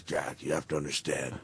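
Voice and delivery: very low, hushed and grave; deep Undertaker voice. Retold or imitated. Wrestler explaining to his colleague why he looks alarming, deep voice. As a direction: sink far down into a quiet deep voice. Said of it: "he's got that deep voice".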